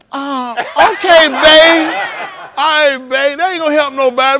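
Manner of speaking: high, loud lightly voiced grandma; mock grandma. Imitating grandma answering the call, high and unbothered. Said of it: "She like".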